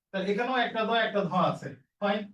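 ordinary talk, nothing marked

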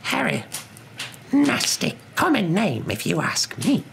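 high, drawn-out disdain; disdainful wife. Acted. Wife tells her husband what she thinks of the name, disdainful.

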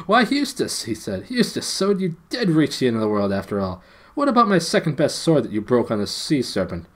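put-on high, loud, delighted young king; young Caspian, joyful. A narrator's reading. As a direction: greet an old friend with joyful teasing.

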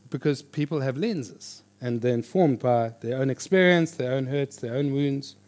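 ordinary delivery

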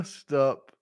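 ordinary delivery

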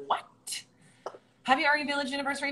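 normal talking voice

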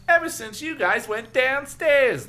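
high, slow, loud boast; drawn-out, high-pitched showing off; bragging. A narrator's reading. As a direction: brag like a bratty little brother. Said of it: "and bragged".

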